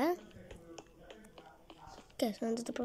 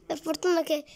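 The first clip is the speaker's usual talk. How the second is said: usual speaking voice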